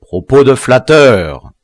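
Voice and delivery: drawn-out, loud scorn; scornful retort. A narrator's reading. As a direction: throw the flattery back at them. General dismisses flattery.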